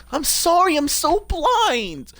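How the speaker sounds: very high, loud, drawn-out cry; wailed apology. Real, not acted. Streamer apologising for an oversight, high loud wail. Wailing apology for missing something.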